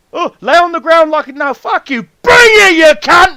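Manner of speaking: huge high roar of defiance; yelling at a bear. Retold or imitated. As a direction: scream it at the bear, full force.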